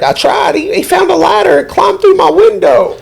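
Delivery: high, whiny woman's reply. Retold or imitated. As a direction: play her high and helpless. Woman's reply voiced in story.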